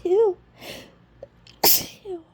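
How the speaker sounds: loud high choked squeal; stifled sneeze. Real, not acted. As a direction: swallow a sneeze sharply. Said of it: "really stuffy now".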